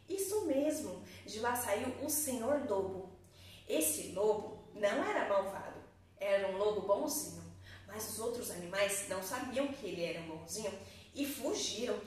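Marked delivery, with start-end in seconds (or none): none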